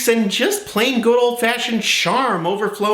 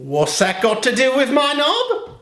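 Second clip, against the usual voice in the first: higher put-on British voice; movie quote imitation; 4 semitones higher than usual. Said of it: "Knob means penis".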